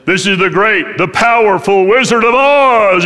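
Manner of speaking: booming, drawn-out projection of a great ruler; Wizard of Oz. Acted. Flashing head announces itself to cowering visitors, booming and grand. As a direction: boom it out grandly, stretching the last words. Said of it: "there was the flashing head".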